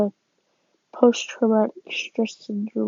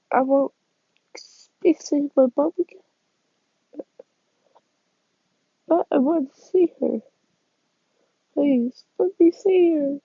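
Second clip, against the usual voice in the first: put-on pleading boy; 5 semitones higher than usual. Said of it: "You can say".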